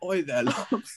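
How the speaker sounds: high mock British come-on; cheeky British lad calling to a love interest; British flirting. Playing a British person flirting, hammed-up British accent. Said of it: "like, a British person flirting with you".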